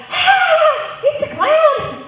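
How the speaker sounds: high, loud scream; scared shriek. Retold or imitated. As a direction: shriek at the clown. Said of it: "They're like".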